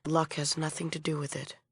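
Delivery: usual speaking voice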